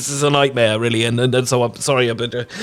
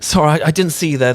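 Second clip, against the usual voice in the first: higher, fluttery, flustered Regency gentleman; silly Regency voice; 6 semitones higher, 4 dB louder than usual. Acted. Regency guest stumbling over a stranger, apologising in a higher silly voice. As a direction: gush apologies up high like a fussy fop. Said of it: "doing the silly voice".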